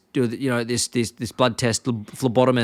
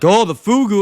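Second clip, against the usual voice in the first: higher, louder hammed-up alarm; mock panic; 8 semitones higher, 5 dB louder than usual. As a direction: play a waiter freaking out, half joking. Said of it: "and they freak out, like".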